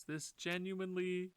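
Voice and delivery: usual speaking voice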